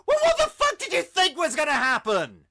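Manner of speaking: high, loud yell of disbelief; full-force incredulous outburst; exasperated shout. Acted. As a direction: shout it at a hopeless fool. Ranting at the character in disbelief.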